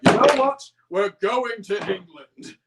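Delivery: a sudden outburst that starts high and loud, then slows; snapping Fat Controller. Retold or imitated. Fat Controller snaps at his engines, high and loud. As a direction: play the boss who finally cracks. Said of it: "he just snaps and he's like".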